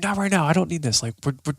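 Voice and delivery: stressed. Retold or imitated. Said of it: "we're freaking out".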